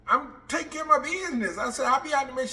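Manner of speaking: calling back from the shower, annoyed. Retold or imitated. Woman in the shower yelling back at the lieutenant, indignant.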